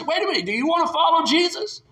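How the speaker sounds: laughing through the question; a laughing dig; amused taunt. Acted. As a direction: tease them with a chuckle. Preacher mocks the taunt.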